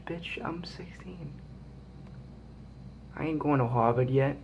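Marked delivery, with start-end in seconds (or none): 0.0-1.3 unvoiced whisper reading aside. Real, not acted. Vlogger reads a question and whispers his retort, slow whisper.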